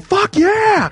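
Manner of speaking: high, loud mock yell of triumph; retold cheer. Gretzky cheering in the stands, retold loud yell. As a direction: belt it out like a fan in the stands. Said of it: "in the stands going".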